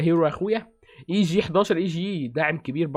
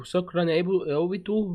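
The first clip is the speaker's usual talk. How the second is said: usual speaking voice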